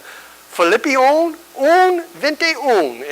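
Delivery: high, slow sing-song recital; kids reciting. Retold or imitated. A preacher imitating orphan kids reciting the verse reference slowly. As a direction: chant the verse reference like a child.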